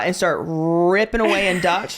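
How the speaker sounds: ordinary talk, nothing marked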